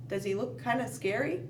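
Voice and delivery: ordinary talk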